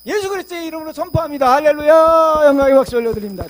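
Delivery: loud, high preaching proclamation; fervent proclamation. Real, not acted. Preacher proclaiming to the congregation, full force. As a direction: shout it out to the whole church.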